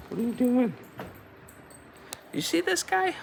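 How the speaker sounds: very high squeaky pet voice. Real, not acted. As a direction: coo at the cat in a tiny high voice. Baby talk to the cat.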